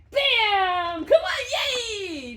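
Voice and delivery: very high, loud yelp of delight; joyful squealing shout; excited shout. Real, not acted. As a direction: shriek it to fire him up. Dog leaps for reward.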